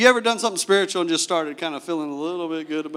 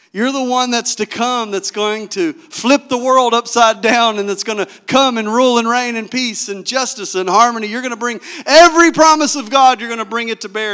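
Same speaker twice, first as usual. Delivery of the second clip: high, loud, building proclamation; acted rousing declaration, swelling to a peak; bold declaration; 4 semitones higher, 6 dB louder than usual. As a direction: proclaim it with mounting fervor.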